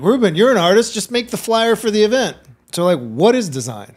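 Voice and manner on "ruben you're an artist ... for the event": higher, louder offhand demand; mock dismissive request. People asking the artist for a flyer, offhand demand. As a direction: toss it off like it's no big deal. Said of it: "even me is like".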